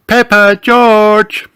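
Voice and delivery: high, loud motherly call. A narrator's reading. Mummy Pig calls her noisy children, loud call. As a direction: call the children from another room. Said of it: "called Mummy Pig".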